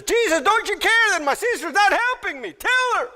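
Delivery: acting out a high, fast, indignant complaint; high-pitched exasperated plea, demanding to be backed up; indignant confrontation. Martha confronting Jesus about her sister, acted indignant complaint. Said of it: "she went and confronted Jesus".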